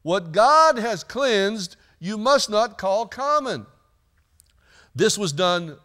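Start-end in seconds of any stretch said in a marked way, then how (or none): 0.0-3.7 slow, louder solemn pronouncement; the heavenly voice corrects Peter, slow. Acted. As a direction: lay each word down slowly. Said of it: "a voice spoke to him again".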